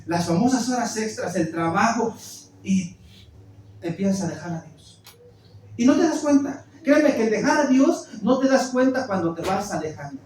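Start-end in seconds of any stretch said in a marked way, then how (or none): none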